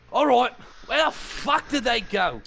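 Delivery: high, loud, slow snarl of a goblin leader; gruff goblin boss voice. Acted. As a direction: bark it out as an angry goblin boss. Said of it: "this is not meant to be Australian".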